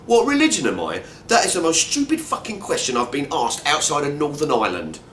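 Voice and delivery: high, loud indignant blurt. Retold or imitated. Detained walker blurting an outraged retort back at the soldiers. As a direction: let the retort burst out in disbelief. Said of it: "I blurt out".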